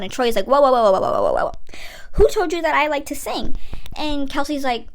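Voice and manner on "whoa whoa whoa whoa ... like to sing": mock panic. Troy panics about singing.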